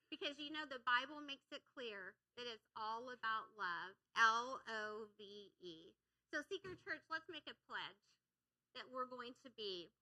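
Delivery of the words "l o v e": spelled out. Shown as a demonstration. Spelling out love for emphasis.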